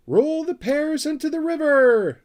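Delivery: high, loud excited squeal; excited scream. Acted. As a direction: shriek it out with glee like a little creature. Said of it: "screamed Sniff excitedly".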